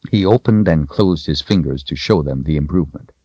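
ordinary delivery, nothing marked

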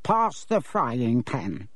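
put-on slow, lazy demand of a bratty boy; rude spoilt boy. A narrator's reading. Spoilt cousin lazily ordering Harry to pass the pan. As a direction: drawl the order like a spoilt brat.

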